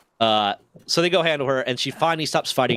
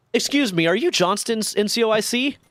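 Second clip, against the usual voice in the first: imitated high-pitched, pointed query; pointed confrontation; 9 semitones higher than usual. Pregnant chief interrupts the yelling sergeant, pointedly confronting. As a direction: cut in as the chief, voice raised in pitch.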